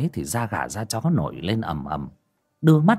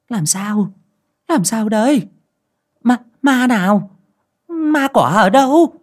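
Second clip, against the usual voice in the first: high, alarmed cries growing louder; startled questioning; 12 semitones higher, 7 dB louder than usual. A narrator's reading. Startled woman questioning her frightened daughter-in-law, alarmed. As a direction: ask in mounting panic. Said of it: "giật mình ôm chầm lấy con dâu".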